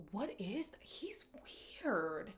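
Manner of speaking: hushed, whispered aside; whispered judgement. Real, not acted. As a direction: whisper it in disbelief. Aside judging the man.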